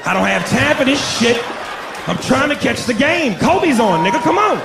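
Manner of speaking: exasperated yelling. Retold or imitated. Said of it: "I said".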